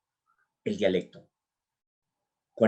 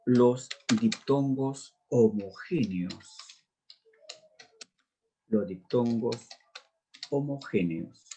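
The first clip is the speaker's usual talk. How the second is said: slow dictation; much slower than usual. Shown as a demonstration. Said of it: "teacher dictating term twice".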